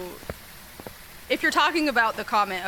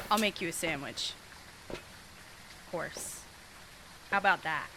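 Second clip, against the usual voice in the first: sarcastic; 6 semitones lower, 12 dB quieter than usual. Acted. Sarcastic mock offer, voice drops.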